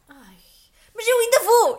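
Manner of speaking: high loud delighted protest; excited exclaim. Retold or imitated. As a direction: burst out like a thrilled kid. Retold excited reply to mom. (grito entusiasmado e agudo)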